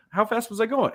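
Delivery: high-pitched clueless question with a laugh in it; playing dumb. Shown as a demonstration. Lawyer acting the clueless driver for the hosts, playing dumb. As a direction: feign innocence, amused. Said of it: "continue to play dumb".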